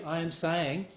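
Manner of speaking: ordinary delivery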